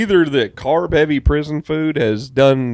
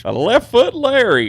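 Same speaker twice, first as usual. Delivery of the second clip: announcer voice; 11 semitones higher, 4 dB louder than usual. Pretend. Joins the announcer bit.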